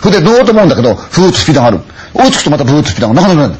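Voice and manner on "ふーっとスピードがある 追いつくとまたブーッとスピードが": plain reading voice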